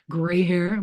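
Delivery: ordinary talk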